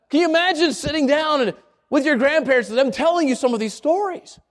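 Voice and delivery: ordinary talk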